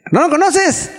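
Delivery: high-pitched, slowed pleading; mock insistent friend at the door; imitating the friend.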